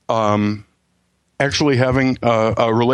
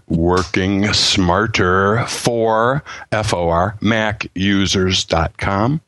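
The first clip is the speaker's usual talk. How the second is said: very slow, spelled-out dictation; slower; 3 semitones lower, much slower than usual. Shown as a demonstration. Guest repeating the website for note-taking, slower. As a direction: say it slowly and deliberately, word by word. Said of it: "let me say it slower".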